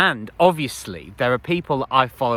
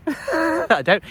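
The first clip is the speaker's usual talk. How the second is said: high laugh into the words; laughing admission. Real, not acted. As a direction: laugh at yourself as you admit it. Laughs at her own admission.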